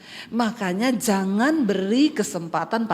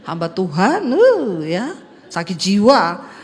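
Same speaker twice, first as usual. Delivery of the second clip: mocking devil; 6 semitones lower, 3 dB louder, slower than usual. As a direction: lower sneering demon voice, slowing on the last words. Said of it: "Sambil giniin saya".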